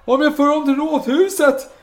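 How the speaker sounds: high, loud appeal to a crowd; calling out. Acted. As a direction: shout a solemn bargain to the mob. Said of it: "Och nu ropar".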